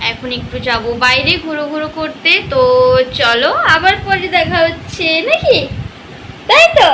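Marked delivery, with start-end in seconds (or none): 3.2-6.9 put-on mother gently coaxing her baby to wave goodbye; mild baby talk. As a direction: lightly coax the baby to say bye.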